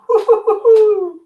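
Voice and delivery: high burst of laughter. Real, not acted. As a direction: cackle high.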